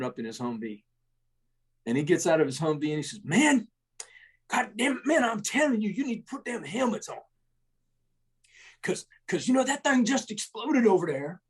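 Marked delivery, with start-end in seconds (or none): 3.2-11.4 imitating a gruff sergeant's scolding, much higher; hammed-up angry sergeant chewing out the troops; first sergeant telling soldiers to put helmets on, mock scolding.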